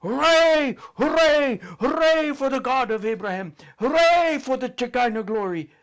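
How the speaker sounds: high, loud crowd cheer; loud triumphant hooray chant; shouting cheer. Retold or imitated. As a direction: belt it like an army marching out. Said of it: "We all shouted".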